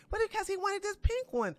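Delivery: high-pitched, put-on retort of a mother; mock protesting mother. Mother answers father about their son's backpack, high mock protest. Voices mother's reply in retold argument.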